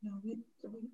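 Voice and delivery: muffled and far from the mic. Real, not acted. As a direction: speak as if through a cloth. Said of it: "She's, like, very muffled".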